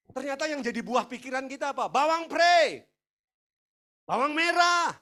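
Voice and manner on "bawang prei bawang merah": loud, high mock cry; seruan lantang. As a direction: call out each item high and loud. Menyerukan idaman yang remeh. (berseru nyaring dan tinggi)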